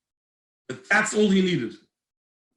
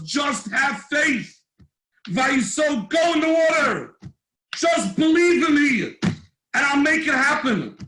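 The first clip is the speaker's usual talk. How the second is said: loud, slow, high urging cry; pleading voice of Hashem; 6 semitones higher, 3 dB louder, slower than usual. Acted. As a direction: plead with them to believe. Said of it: "what Hashem was saying was".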